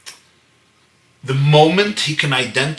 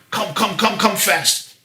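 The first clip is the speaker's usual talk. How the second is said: higher, insistent calling; quick repeated beckoning, pitched up; urgent beckoning; 8 semitones higher than usual. Retold or imitated. Wife motioning her husband out of the wedding meal, urgent beckoning.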